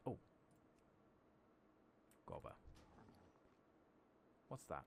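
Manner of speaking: ordinary talk, nothing marked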